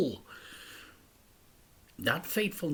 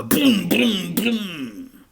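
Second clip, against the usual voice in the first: sound effect; 7 semitones higher, 6 dB louder, much slower than usual. A narrator's reading. Sound effects of rolling car.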